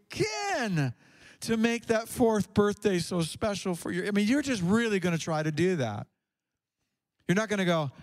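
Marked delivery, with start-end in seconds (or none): none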